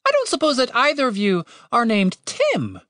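high-pitched bird voice, tentative question; polite talking bird. A narrator's reading.

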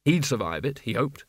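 ordinary delivery, nothing marked

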